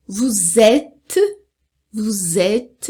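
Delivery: vous êtes linked as "vuzét", final S silent; drawn-out model of vous êtes, crisp T; silent endings. Shown as a demonstration. Teacher showing all the mute letters, careful vous êtes. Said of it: "tudo mudo".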